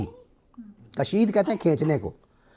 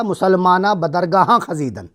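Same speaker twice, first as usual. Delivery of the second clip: poem recital; 3 semitones higher, 4 dB louder than usual. Acted. Recites Persian verse.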